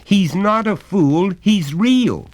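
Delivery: slow, high, loud insistence; the boy defending his friend; passionately. Acted. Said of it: "said the little boy passionately".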